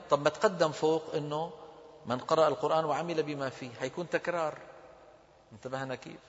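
ordinary delivery

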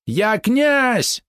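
very high, shrieking cry; the voice breaks upward into a squeal; voice cracking into a shriek. Acted. Polot insists he is prince, voice cracking into a shriek. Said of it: "Голос Полота сорвался на визг".

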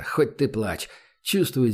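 ordinary delivery

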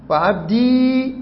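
عبدي slowly stretched on a high, held ee; long final ya. Shown as a demonstration. Teacher listing variant forms, long ee on عبدي. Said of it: "إثبات الياء ساكنة".

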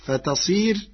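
normal talking voice

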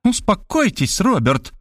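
mildly cross rebuke; annoyed. Acted. Detective woman crossly telling the writer to calm down. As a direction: snap at him to calm down, lightly. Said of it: "рассердилась она".

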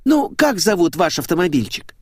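put-on high girlish exasperation; exasperated blonde. A narrator's reading. Blonde asks the car's name, exasperated. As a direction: start loud and high, then ease down.

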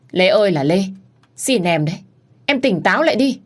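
loud, high anguished begging; desperate plea. Acted. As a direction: cry out begging her to wake up. Sister begs mad Lê. (chị gái gào xin em tỉnh lại)

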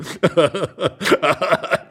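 long fit of chuckling; amused laughter. Acted. Couple laughing at the guess, amused. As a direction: laugh on helplessly.

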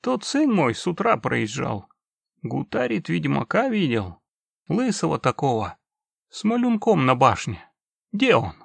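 put-on mumbling toothless old man. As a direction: mumble it like an ancient grandpa. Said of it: "прошамкал дедуля".

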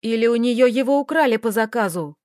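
ordinary delivery

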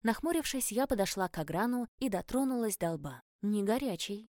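ordinary delivery, nothing marked

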